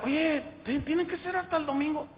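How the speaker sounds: high, pleading, impatient; can't wait until Sunday; impatient convert. Retold or imitated. Young man begging his sister not to wait, high and impatient. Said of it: "yo le decía".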